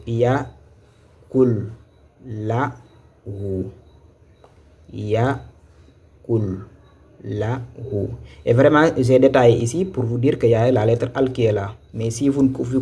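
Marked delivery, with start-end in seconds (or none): 0.1-3.7 Ya, kul, la, hu with a pause after each; syllable by syllable. Shown as a demonstration. Teacher showing students how to read it, syllable by syllable. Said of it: "C'est comme ça qu'il faut lire". 4.9-8.1 Ya... kul... la hu, broken by pauses; syllable by syllable. Shown as a demonstration. Teacher repeating the reading for students, syllable by syllable. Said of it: "C'est comme ça qu'il faut lire".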